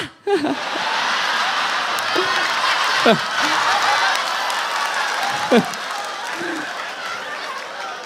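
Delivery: long airy jeering laugh; breathy mocking cackle. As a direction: cackle at the crybaby. Mocking crying patients. (tawa mengejek panjang)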